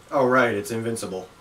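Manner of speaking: ordinary talk, nothing marked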